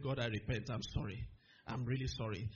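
low-pitched contrite apology; repentant prayer. Retold or imitated. Young believer praying to God after cursing someone, low and penitent. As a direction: drop the pitch and confess. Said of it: "began to pray and repented of it".

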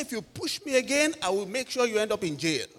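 high-pitched retold warning; acted-out fuming ultimatum. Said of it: "I was really fuming".

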